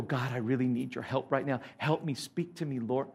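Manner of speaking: soft, low acted prayer; silent plea to God. Businessman praying privately mid-meeting, soft acted plea. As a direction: pray under your voice for help. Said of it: "you just go, 'God".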